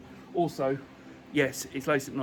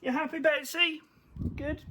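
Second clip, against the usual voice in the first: high sing-song pet talk; talking to a dog; 12 semitones higher than usual. Real, not acted. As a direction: coo at the dog. Talking to pets.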